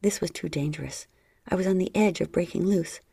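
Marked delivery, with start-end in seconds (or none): none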